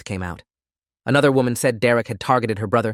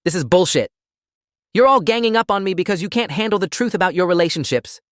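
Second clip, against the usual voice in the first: raised, higher-pitched desperate protest; cornered, pitched-up indignation; desperate outburst; 8 semitones higher, 3 dB louder than usual. A narrator's reading. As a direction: lash out with a desperate edge. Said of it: "edged with desperation".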